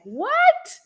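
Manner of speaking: a sudden high, loud "What?"; shocked what. Retold or imitated. To her son, a shocked high "What?". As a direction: caught completely off guard. Mom shocked by son's remark.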